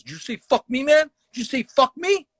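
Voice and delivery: high-pitched, incredulous challenge; imitated indignant heckler. Heckler cuts the comic off, indignant imitation. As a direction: act out a heckler taking offence, voice climbing.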